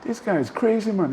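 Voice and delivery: high disbelieving inner voice; amazed thought. Retold or imitated. Storyteller amazed at Puff partying, high disbelieving thought. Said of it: "And I'm thinking".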